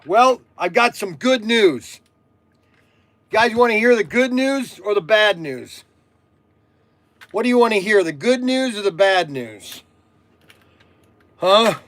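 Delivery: loud, high call to the viewers; yelling. Real, not acted. Streamer asking viewers to pick good or bad news, yelling. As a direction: holler it out, drawing out the words. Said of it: "why am I yelling".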